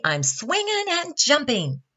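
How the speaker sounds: high-pitched, lively shout. A narrator's reading. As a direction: holler like a playful monkey. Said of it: "shouted Monkey".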